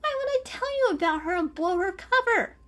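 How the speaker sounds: high, loud urgent denial; protesting. Acted. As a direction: insist hard she's alone. Cat protests loudly.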